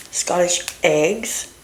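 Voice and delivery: usual speaking voice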